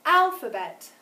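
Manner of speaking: "Alphabet" with a loud, high, long first syllable; stress on first syllable. Shown as a demonstration. Teacher tries another word for pupils, stressed start of "Alphabet". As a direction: hit the start of "Alphabet" and drop on the rest. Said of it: "because the first syllable was louder, longer, and higher".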